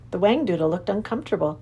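ordinary delivery, nothing marked

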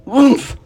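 high, loud "oomph" as the pig lands; grunt. A narrator's reading. Pig grunting as he hits the ground, loud. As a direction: grunt on landing with a thump. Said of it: "he grunted".

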